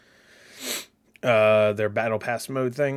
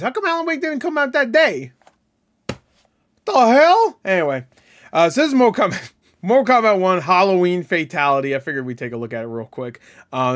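ordinary talk

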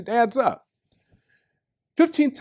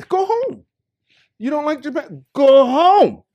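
rant; 7 semitones higher, 6 dB louder, slower than usual. Real, not acted. Ranting at complaining foreigners.

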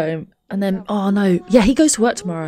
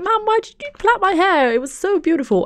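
put-on high, whiny little-girl voice, much higher; mock toddler complaint; 12 semitones higher than usual. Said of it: "It's like".

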